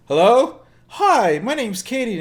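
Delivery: high, loud chirpy greeting; perky caller. Retold or imitated. Mimicking the warranty caller, high and chirpy. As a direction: chirp like a cheerful telemarketer.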